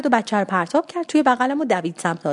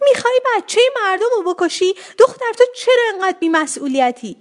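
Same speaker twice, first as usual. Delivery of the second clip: very high-pitched acted scolding; outraged mother, shrieky but moderately loud; mother's scolding yell; 10 semitones higher, 4 dB louder than usual. A narrator's reading. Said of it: "فریاد مامان میترا مغزم رو لرزوند".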